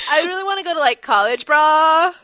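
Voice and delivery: loud, high-pitched bro voice; frat bro. Retold or imitated. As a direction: ham up an excited frat bro. Continues bro voice.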